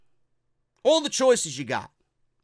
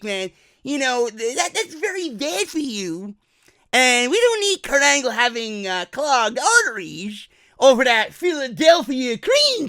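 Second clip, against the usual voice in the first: higher, loud put-on employee; lazy buffet employee; 5 semitones higher, 6 dB louder than usual. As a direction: employee explaining no dessert. Employee voice again.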